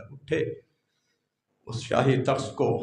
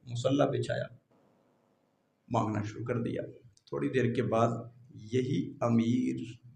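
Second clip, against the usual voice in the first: usual speaking voice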